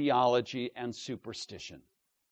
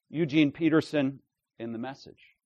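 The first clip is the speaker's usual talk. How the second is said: ordinary talk, nothing marked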